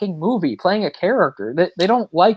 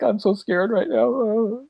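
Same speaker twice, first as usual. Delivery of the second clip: slow, high mock-tearful whine; hammed-up tearful wail; mock crying; 7 semitones higher, 3 dB quieter, much slower than usual. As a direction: imitate her crying, drawn out. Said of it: "and then she started crying".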